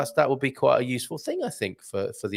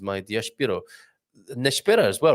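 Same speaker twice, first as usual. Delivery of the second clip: ordinary talk, nothing marked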